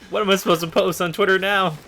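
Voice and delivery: high, loud mock despair; hammed-up panic. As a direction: wail over the lost camera like it's a tragedy. Camera breaks, mock panic.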